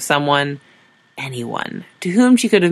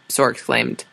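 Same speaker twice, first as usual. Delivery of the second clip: usual speaking voice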